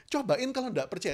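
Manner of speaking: normal talking voice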